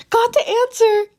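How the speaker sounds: triumphant announcement. Acted. Izuku returning to his friends with the answer, high and excited. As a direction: burst in with the news.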